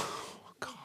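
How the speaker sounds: a soft unvoiced "oh God" of dread; dismayed whisper. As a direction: breathe it out like a caught kid. Kid's dismayed reaction.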